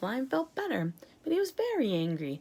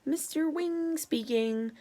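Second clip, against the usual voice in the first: small distant voice; 7 semitones higher, slower than usual. A narrator's reading. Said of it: "said a small, distant voice".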